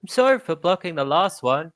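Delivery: high, chirpy apology; a bright creature praising the fighter; cheery creature voice. Acted. Creature praising the fighter who cracked it, high and chirpy. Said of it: "but it goes".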